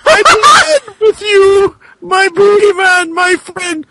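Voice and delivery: high, crying plea in a put-on voice; pleading high voice. Character begging the boogeyman for help, high wailing plea. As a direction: beg for help in a wailing squeak.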